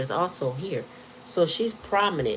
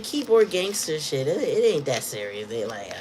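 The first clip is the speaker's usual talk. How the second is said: normal talking voice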